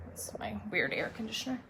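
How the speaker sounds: hushed unvoiced whisper; whispered aside. Real, not acted. To viewers while on air, a hushed whisper. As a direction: whisper it so the mic barely catches it.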